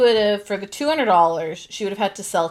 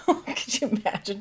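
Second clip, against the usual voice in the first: usual speaking voice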